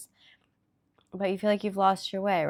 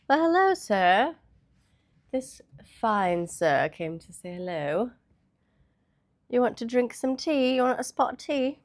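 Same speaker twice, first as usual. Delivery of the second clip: playful, higher-pitched mock British accent; 5 semitones higher, 4 dB louder than usual. Greeting and offering tea to a visitor, playful British accent. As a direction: put on a British accent, loud and lilting. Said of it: "getting into this British accent".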